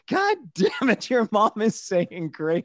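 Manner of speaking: high, slow mock outburst from dad; a hint of dad's exasperation; imitating dad. Retelling dad cursing during grace, slow mock outburst. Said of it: "your dad said".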